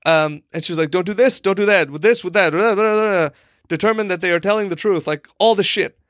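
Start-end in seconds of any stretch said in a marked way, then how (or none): 0.9-3.3 higher sing-song list of don'ts; mock rule-reciting. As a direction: rattle the rules off into blah-blah. Woman rattling off many rules.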